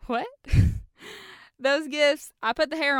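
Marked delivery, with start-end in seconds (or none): 0.3-1.3 breathy burst of laughter. Real, not acted. Shock at the wrong gifts.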